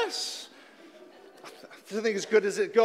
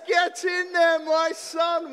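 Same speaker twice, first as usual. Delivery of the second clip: excited cheer; 4 semitones higher, 4 dB louder, slower than usual. Acted. Goal celebration, shouting at TV.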